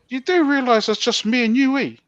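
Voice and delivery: high, insistent retold challenge; confrontational warning. Prisoner alone with the pastor behind a closed door, confrontational. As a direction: press the point as an acted-out threat.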